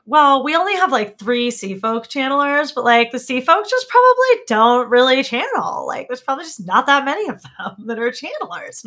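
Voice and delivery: put-on bubbly high Valley Girl voice; Valley Girl accent. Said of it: "with the same Valley Girl accent".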